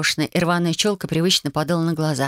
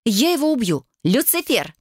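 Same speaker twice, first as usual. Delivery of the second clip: higher-pitched exasperated cry; acted annoyance rising in pitch; angry outburst; 6 semitones higher than usual. A narrator's reading. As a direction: rage at the misbehaving cat, pitched up. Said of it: "рявкнула Вайолет".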